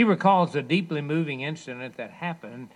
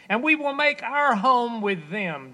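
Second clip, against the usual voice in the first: preaching shout; 10 semitones higher, 7 dB louder than usual. Acted. Preaching the verse at peak force.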